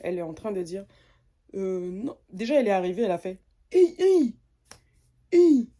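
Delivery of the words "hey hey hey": mock loud, high hey hey hey; casual hey greeting. Mocking the girl who greets her with a loud high hey. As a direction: fling out a cheeky hey like to a buddy. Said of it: "Au lieu de dire bonjour".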